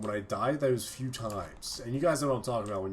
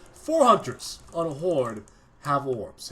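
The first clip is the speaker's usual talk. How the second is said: loud, slow, higher outburst of disbelief; 4 semitones higher, 9 dB louder, slower than usual. Real, not acted. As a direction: drag it out loud like you can't believe the count. Shocked at hunters with orbs.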